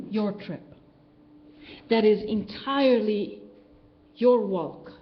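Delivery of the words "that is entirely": normal talking voice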